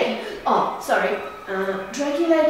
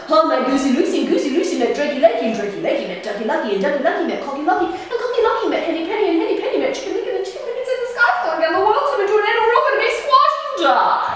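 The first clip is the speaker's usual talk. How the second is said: very fast, rising ever higher; breathless rush; 5 semitones higher, much faster than usual. Acted. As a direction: gabble the whole chain in one breath. Breathless recap in story.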